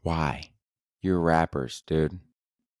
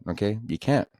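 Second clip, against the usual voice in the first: ordinary talk, nothing marked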